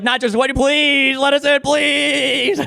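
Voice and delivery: high, squeaky, drawn-out begging plea; mock pleading countries. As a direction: beg in a tiny mocking voice, stretching the last please. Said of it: "They were like".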